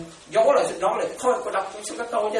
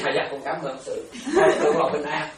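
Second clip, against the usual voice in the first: usual speaking voice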